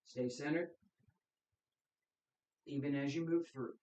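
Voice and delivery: steady instructor cues, exhale words pushed out; guided qigong cues. Acted. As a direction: lead the class through breath and movement. Leads qigong breathing practice.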